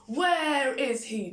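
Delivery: loud, high demand; demanding giant. A narrator's reading. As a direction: bark it at the room. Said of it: "demanded the giant".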